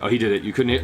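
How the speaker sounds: usual speaking voice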